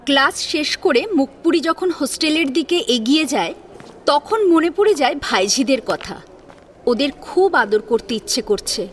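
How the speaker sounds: ordinary delivery, nothing marked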